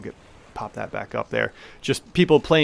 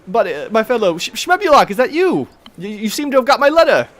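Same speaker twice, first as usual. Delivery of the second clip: much higher, louder, bubbly halfling host; Tamili the halfling; 11 semitones higher, 8 dB louder than usual. Acted. Halfling owner welcoming the adventurers she summoned, high and cheery. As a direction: greet them like a delighted busy hostess. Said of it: "She's like".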